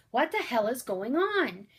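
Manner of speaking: Corey demanding answers amid panic, loud; yelling. A narrator's reading. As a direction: shout the question, alarmed. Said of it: "Corey yelled".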